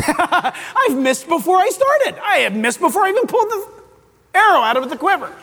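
chuckling through the words; laughing. Real, not acted. Preacher laughing at missing the first commandment. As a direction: laugh at your own failure.

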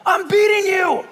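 high, loud comic comeback; hammed-up indignant prophet; mock angry retort. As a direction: snap back at the donkey, pitched high. Prophet answering his donkey in quarrel.

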